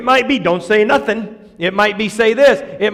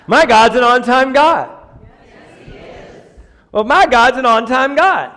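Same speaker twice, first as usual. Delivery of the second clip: loud, high preacher's declaration; preaching; 4 semitones higher, 4 dB louder than usual. Real, not acted. Preacher declaring God on time to the church, preaching. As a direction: proclaim it at full force to the room. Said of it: "we'll just preach to you".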